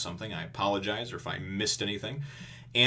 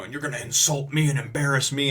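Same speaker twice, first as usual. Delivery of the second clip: mock-angry bluster, higher and louder; big and loud; 5 semitones higher, 6 dB louder than usual. Iron Fist threatening Sanji, big mock bluster. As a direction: puff up into a retold threat. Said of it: "he's getting all big and loud".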